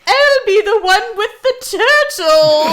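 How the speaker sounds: high, loud dating-profile voice; hammed-up blind date line, high and loud; mock blind date voice. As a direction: sing it out like a hopeful blind date. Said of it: "She's like".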